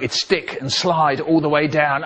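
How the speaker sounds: ordinary talk, nothing marked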